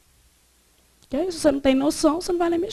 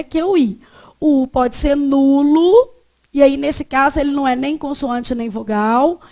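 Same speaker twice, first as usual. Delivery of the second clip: normal talking voice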